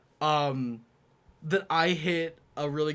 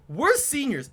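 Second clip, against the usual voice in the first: smug; 11 semitones higher, 6 dB louder than usual. Retold or imitated. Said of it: "And he goes".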